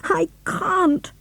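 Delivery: high, tearful plea; a sobbing girl who cannot do it. A narrator's reading. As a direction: she refuses through tears. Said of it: "sobbed George".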